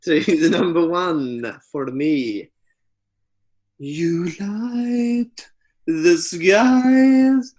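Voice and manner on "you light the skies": put-on Swedish accent. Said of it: "Doing that in a Swedish accent again".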